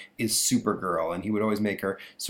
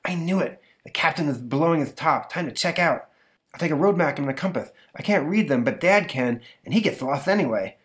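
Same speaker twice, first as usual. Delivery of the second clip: put-on higher kid voice plotting; scheming boy; 6 semitones higher than usual. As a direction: plot running away under his breath. Boy plans to run away.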